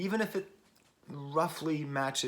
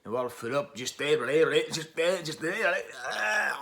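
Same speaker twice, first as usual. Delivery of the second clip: a rough, self-mocking Scottish accent, rising and louder; 4 semitones higher, 6 dB louder, slower than usual. Reviewer mimics the album's Scottish singer, Scottish accent. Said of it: "I don't do a good Scottish accent".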